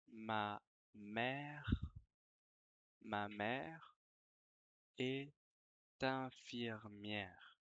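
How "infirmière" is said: ordinary talk, nothing marked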